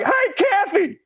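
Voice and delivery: high, loud call across the room; retold yell. Nurse calling to Kathy across the ward, high laughing yell. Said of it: "yelling and telling everybody else".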